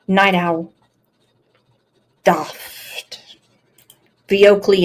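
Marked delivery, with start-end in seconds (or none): 2.2-3.2 whispered Florida "Doft."; soft mock-Florida "Doft."; Florida accent. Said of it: "in her Florida accent".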